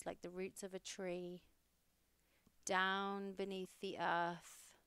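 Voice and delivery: calm meditation guide, unhurried phrases with long pauses; guided meditation. Acted. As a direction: ease the listener inward, phrase by phrase, leaving space to breathe.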